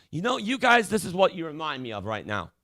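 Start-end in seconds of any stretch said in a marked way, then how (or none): none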